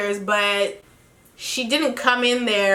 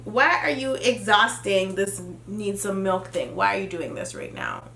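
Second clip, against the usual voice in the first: low, soft, fed-up muttering; quietly exasperated, trailing off; 5 semitones lower, 6 dB quieter than usual. Retold or imitated. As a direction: sigh it out under your breath, done with him. Exasperated at his milk fixation.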